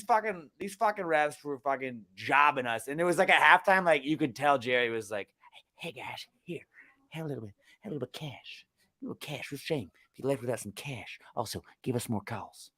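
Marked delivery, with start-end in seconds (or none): 5.8-12.7 very soft, furtive imitation of a bribe; under-the-breath mock payoff pitch; hushed sly aside. Imitating Jerry slipping refs cash.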